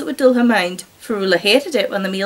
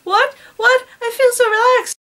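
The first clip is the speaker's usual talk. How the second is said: high, dreamy drugged voice; dazed girl; 12 semitones higher than usual. A narrator's reading. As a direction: sound woozy and blissed out. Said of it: "the girl continued to mumble".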